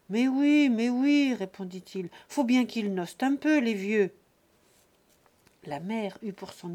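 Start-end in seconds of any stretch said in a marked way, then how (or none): 0.1-0.7 a louder, higher, jovial "Mais oui"; drunken eager yes. Acted. Drunk son brushes off the haggling, jovial and loud. 0.7-1.3 put-on drunken eager yes. Tipsy son agreeing to everything, cheerful and loud. 2.3-4.1 put-on loud, easy-going drunk talk; tipsy indulgence. Drunk son excusing the old folks, loud and jovial.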